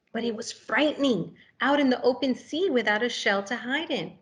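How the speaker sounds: animated picture-book narration; reading to children. A narrator's reading. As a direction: read it like it's story time for little kids.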